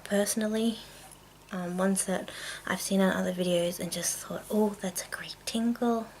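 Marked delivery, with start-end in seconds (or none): none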